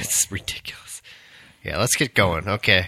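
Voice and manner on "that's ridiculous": hushed whisper. Real, not acted. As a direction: whisper it under your breath. Aside under breath.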